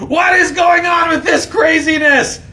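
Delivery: high, loud outraged shout; furious prophet shouting down the crowd; Moses outraged. Acted. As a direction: yell at them in disbelief. Moses shouting at idol worshippers.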